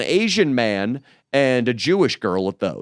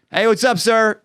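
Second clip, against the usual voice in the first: louder, higher cop approach; hot-headed cop; 9 semitones higher, 4 dB louder than usual. Retold or imitated. Confronting the bum as a cop, louder and higher. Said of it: "I come in hot on this guy".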